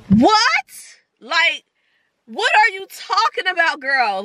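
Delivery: 훨씬 높고 크게 놀란 외침; shocked what. Real, not acted. Reacting to waitress's keep going.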